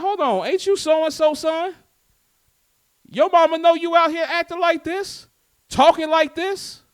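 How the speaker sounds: acting out an indignant neighbor's rebuke; scolding neighbor. Neighbor catches someone's child acting up in the streets, scolding.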